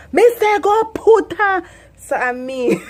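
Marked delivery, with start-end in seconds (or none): 0.1-1.6 high, loud scolding in Patois; loud Jamaican order. Retold or imitated. Jamaican parent yelling when child has no slippers. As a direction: yell it like an angry parent. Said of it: "if you don't have on your slipper".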